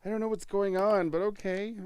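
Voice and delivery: loud, high exclamation after whispering; whisper yelling. Real, not acted.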